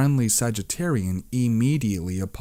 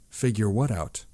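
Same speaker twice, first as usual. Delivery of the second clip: usual speaking voice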